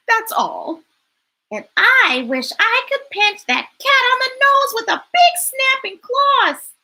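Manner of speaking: a fed-up bird complaining; indignant bird. Acted. Bird's indignant reply.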